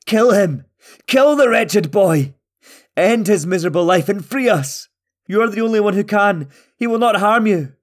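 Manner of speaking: high, loud crowd cries; shouted encouragement. A narrator's reading. Villagers urging father to kill the boy, shouted. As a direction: urge him on from below at a full shout. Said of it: "the villagers below shouted encouragement".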